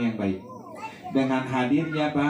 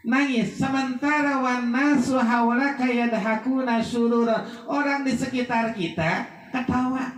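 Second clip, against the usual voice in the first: raised, emphatic sermon voice; fervent preaching; 10 semitones higher than usual. Acted. Preacher warning the funeral crowd, raised and emphatic. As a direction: drive the warning home to the mourners.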